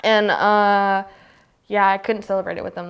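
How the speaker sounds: ordinary talk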